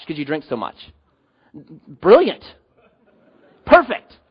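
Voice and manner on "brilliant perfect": high, loud mock congratulations; single words punched with false admiration; sarcastic praise. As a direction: cheer the stupidity sarcastically. Mock praise of getting drunk.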